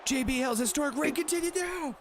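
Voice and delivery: high-pitched mock commentator call; mock announcer. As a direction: call the title reign like an excited announcer. Commentating on the game's title match.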